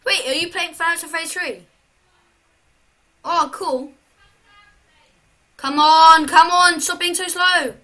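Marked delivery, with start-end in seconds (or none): 5.6-6.8 loud, urgent shout at the screen. Real, not acted. Gamer yelling at the slow character, loud urging. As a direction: yell them on, impatient.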